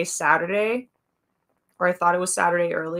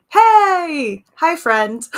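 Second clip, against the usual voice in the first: excited greeting; 9 semitones higher, 9 dB louder, much slower than usual. Real, not acted. Greeting a friend arriving.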